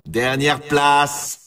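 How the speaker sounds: low, slow, drawn-out disappointment; game announcer deflated by a loss; let-down announcement. Acted. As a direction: announce a dismal result, dragging the words. Announcer gives last-place result.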